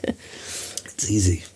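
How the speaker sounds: whispered and very slow; breathy aside. Pretend. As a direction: breathe it out slowly under the voice. Mocking shouting at Alexa.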